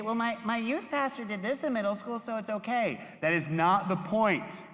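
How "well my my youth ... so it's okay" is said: high, silly teen voice mimicking the kids; mocking student voice. Youth pastor mocking a student's excuse, high and silly. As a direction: lampoon a kid twisting your words. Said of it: "you don't actually sound like that. That's just the voice I was doing".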